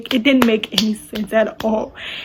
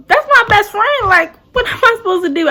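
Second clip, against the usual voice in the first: higher, louder protest; pleading, raised-voice reaction; upset disbelief; 10 semitones higher, 5 dB louder than usual. Retold or imitated. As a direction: retell it as a distressed outburst. Upset reaction to bad news.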